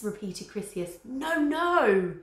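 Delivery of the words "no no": high loud shocked no. Acted. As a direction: gasp it out in horror. Flavia gasping in shock.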